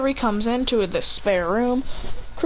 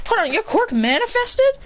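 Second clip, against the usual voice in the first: high-pitched surprised question; astonished mother; 11 semitones higher than usual. Acted. Mother learning of son's quirk, high surprised voice. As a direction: seize on the news in amazement.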